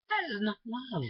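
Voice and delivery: "That is not love" pitched very high; insistent repeated denial. Real, not acted. Repeats the conclusion more forcefully.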